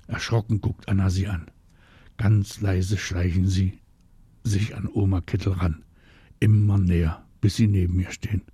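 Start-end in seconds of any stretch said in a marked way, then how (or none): none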